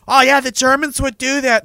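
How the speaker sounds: high-pitched mock objector voice; mocking imitation. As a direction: parody a naive know-it-all. Mimicking a naive objector.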